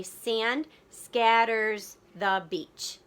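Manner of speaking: very slow, syllable by syllable; slow syllable-counting read; counting beats. Shown as a demonstration. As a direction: stretch every beat so kids can count. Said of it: "So I would say".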